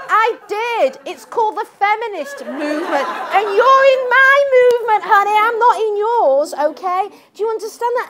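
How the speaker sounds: loud, high, laughing put-down; loud retort. Retold or imitated. Speaker retelling her answer on BBC, laughing loud retort. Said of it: "So I said".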